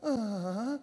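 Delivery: very soft, weak whimpering grunts; feeble whimper. Retold or imitated. Mimicking someone worn out, soft whimper. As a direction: sound like you're on your last leg. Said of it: "you're on the last leg".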